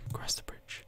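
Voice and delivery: very soft echoing whisper; hushed, voiceless repeat. Pretend. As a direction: whisper it as an echo of yourself. Echoes own words in whisper.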